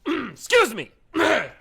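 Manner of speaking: loud shout. Acted. Throat clearing, loud outburst.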